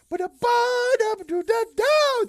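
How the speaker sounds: high, loud scatted flute melody; imitated flute tune. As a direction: toot it out like a flute. Dog playing the flute.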